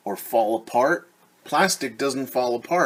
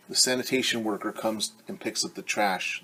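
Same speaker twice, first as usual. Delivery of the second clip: ordinary delivery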